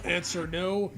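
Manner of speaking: usual speaking voice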